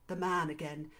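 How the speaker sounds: plain reading voice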